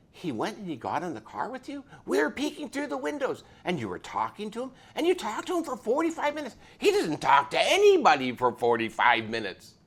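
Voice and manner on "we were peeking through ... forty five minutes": ordinary delivery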